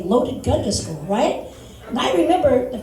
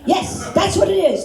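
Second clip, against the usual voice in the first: higher, emphatic yes; relieved agreement; 7 semitones higher, 4 dB louder than usual. Acted. Relieved teacher confirming the kid's answer, eagerly emphatic. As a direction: jump gladly on the wrong answer.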